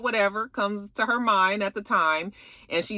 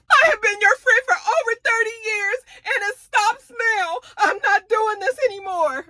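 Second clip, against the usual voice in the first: high, tearful outburst through sobs; crying; 4 dB louder than usual. Retold or imitated. Jacqueline crying as she tells Mia off, sobbing high. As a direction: wail it like a friend betrayed. Jacqueline ending a thirty-year friendship.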